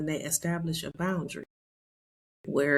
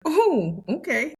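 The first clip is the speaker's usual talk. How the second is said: high, loud startled "Oh, okay"; taken aback; 10 semitones higher, 6 dB louder, much slower than usual. Retold or imitated. Retelling her reaction to her son: high startled okay. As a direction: caught off guard by the ring news. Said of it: "I'm like".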